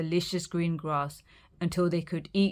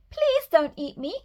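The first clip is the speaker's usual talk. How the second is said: put-on high, squealing baby goat; high little kid goat answering; squeaky little goat; 10 semitones higher, 3 dB louder than usual. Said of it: "he squealed".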